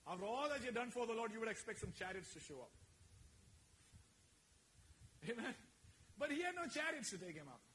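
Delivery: full-force sermon call; fiery preaching. Acted. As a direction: preach it out to the congregation.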